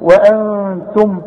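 very slow "وأنتم" with long nasal n; two-count ghunna. Shown as a demonstration. Teacher demonstrates for pupil, held nasal hum. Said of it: "هغن واخفي".

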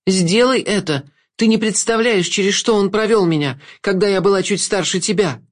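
high, urgent female voice; high-pitched anguished urging; Agnessa. A narrator's reading. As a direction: demand vengeance in a high, insistent pitch. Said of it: "Голос принадлежал Агнессе".

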